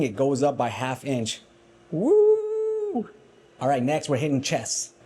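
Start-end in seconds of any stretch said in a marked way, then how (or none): 1.9-3.1 high, drawn-out "woo"; celebratory whoop. Real, not acted. Celebrates the flexed arm measurement.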